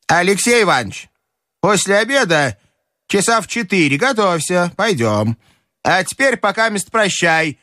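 high grandmother, easing down at the end; бабушка распоряжается. Acted. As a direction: give orders in a high old-lady voice. Grandmother gives instructions. (высокий распорядительный голос бабушки)

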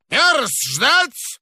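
put-on high, creaky old man's command; an old director shouting through the wall; hoarse old man. A narrator's reading. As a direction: snap it like a tetchy old boss. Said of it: "старческий охрипший голос".

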